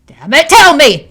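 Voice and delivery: high, loud demanding yell; a furious full shout. A narrator's reading. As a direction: force the answer out of her. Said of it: "Amanda yells".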